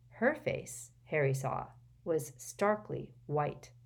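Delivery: plain reading voice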